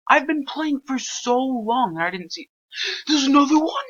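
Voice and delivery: frustrated gamer exclaiming; exasperated high-pitched outburst. Real, not acted. Frustrated at missing clue.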